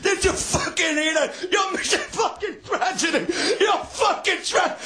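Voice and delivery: high yelling through laughter; laughing shout. Real, not acted. Comic mocks the heckler's line, laughing shout. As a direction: crack up while shouting him down.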